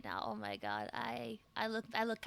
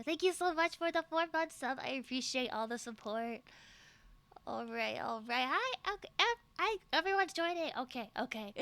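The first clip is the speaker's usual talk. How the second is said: normal talking voice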